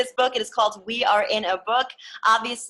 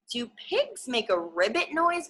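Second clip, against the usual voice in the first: usual speaking voice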